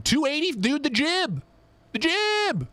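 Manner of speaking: hyped streamer spotting a new knife; excited. Real, not acted. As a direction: squeal the jib with delight. Excited about a knife drop.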